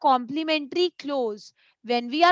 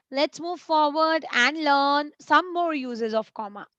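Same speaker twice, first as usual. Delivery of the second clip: ordinary delivery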